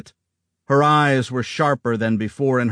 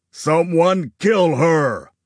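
loud, higher, slow yell; 6 semitones higher, 4 dB louder, slower than usual. A narrator's reading. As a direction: roar the order across the ridge. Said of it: "Augustus yelled".

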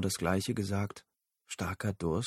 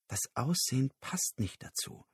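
leise gedacht; 4 semitones higher, 6 dB quieter than usual. A narrator's reading. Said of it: "dachte er".

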